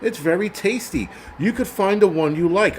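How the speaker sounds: lightly voiced mock vegan. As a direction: parody a vegan selling you on hummus.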